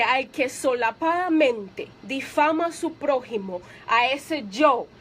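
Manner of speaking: slow, grave reading of the verse; solemn scripture reading. Acted. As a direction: read the warning slowly, weighing each word. Reads psalm slowly. (lectura lenta y solemne del salmo)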